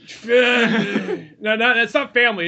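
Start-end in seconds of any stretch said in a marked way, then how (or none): 0.1-1.3 very slow, stretched word; mock-dramatic slow delivery; drawn-out catchphrase. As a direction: draw the word right out for a joke.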